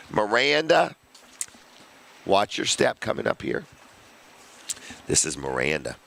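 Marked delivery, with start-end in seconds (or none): 0.1-0.9 calling out. Real, not acted. Calling guest to the mic.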